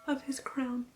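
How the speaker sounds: ordinary delivery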